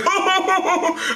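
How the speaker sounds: high, wavering hoot of fake crying; hammed-up "oh-oh-oh" wail; high mock wail. Angry crowd crying out, mock wailing.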